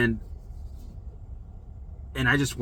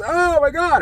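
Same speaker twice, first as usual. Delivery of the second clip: a high, loud burst of waking panic; high, loud mock panic on waking; panicked cry; 7 dB louder, slower than usual. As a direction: shriek it out as pain hits on waking. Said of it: "I'm freaking out because I got this intense pain".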